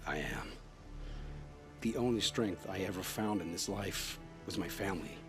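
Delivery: low, soft confession; resigned admission. Acted. Captive admits weakness before his judge, low and resigned. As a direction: sink into a subdued, defeated murmur.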